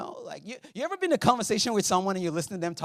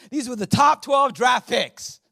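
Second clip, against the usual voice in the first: louder, higher sports-announcer punch; sudden shout; 8 semitones higher, 8 dB louder than usual. Acted. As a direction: burst out after the hush.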